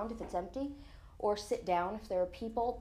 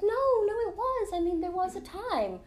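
much higher, eager to set him straight; insistent protest; 10 semitones higher than usual. Retold or imitated. Correcting the doubting husband at the bar, high eager insistence.

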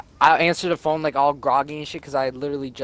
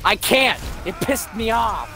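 higher, slow, whining; put-on whiny voice, pitched up; whiny complaint; 6 semitones higher, slower than usual. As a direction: drag it out like a sulking kid. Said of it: "And I was like".

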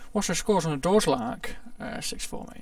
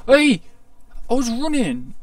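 loud protesting yell; indignant shout; 6 semitones higher, 9 dB louder than usual. Real, not acted. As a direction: shout it out, outraged. Killed while running, protests.

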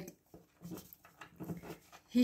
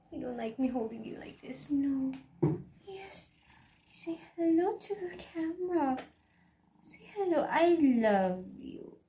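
higher, softer coaxing; 4 semitones higher, 3 dB quieter than usual. Real, not acted. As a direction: softly urge the kitten to greet. Cooing to her cat.

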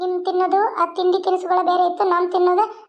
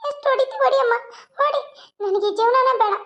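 higher, sobbing protest; acted crying; 7 semitones higher than usual. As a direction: wail that she wants to die. Beaten daughter cries out.

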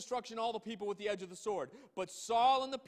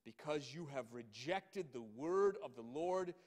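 much lower and softer, grave; solemn rebuke; 8 semitones lower, 6 dB quieter than usual. Acted. Samuel pronouncing judgment on Saul, low and grave. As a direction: deliver the judgment quietly.

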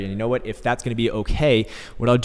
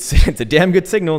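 laughing; 8 semitones higher, 7 dB louder than usual. Real, not acted. Laughing emphatic aside.

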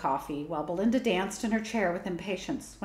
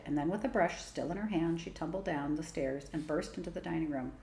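ordinary delivery

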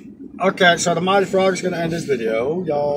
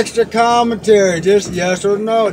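louder, each word pressed home; insistent; 4 semitones higher, 6 dB louder than usual. Real, not acted. Cook presses his friend for a yes or no, insistent. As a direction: demand a straight answer. Demanding a straight answer.

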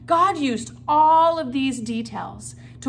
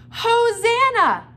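much higher, loud, drawn-out cry; joyful shout; 11 semitones higher, 7 dB louder, much slower than usual. Acted. Crowd shouting Hosanna at Jesus, loud and drawn out. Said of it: "Can you guys say the word Hosanna?".

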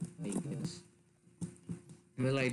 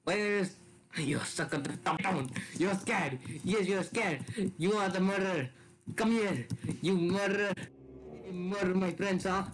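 mock interrogation; 5 semitones higher than usual. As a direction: grill her like a cop. Accusing Veronica of murder.

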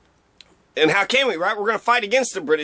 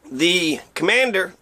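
normal talking voice

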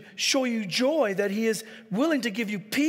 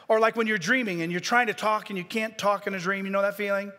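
ordinary talk, nothing marked

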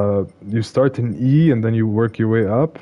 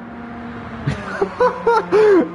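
high squealing laugh; 11 semitones higher than usual. Real, not acted. Laughing at replay.

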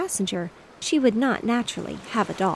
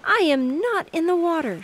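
put-on much higher and louder little girl's protest; a small girl crying out in protest; indignant cry; 10 semitones higher, 5 dB louder than usual. A narrator's reading. Girl denies to brother she's in the water, crying out. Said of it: "she cried".

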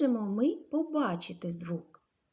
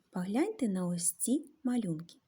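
usual speaking voice

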